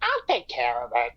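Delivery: low calm character voice. Acted. Character reassures others.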